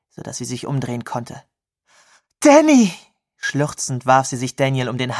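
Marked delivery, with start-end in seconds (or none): 2.4-2.9 high, loud cry of his name; emotional outcry. A narrator's reading. Girl recognising Daniel in the dark, emotional outcry.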